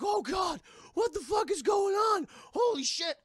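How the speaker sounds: high, loud horrified outburst; father finding his home in chaos; shocked yell. Acted. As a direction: shout in disbelief.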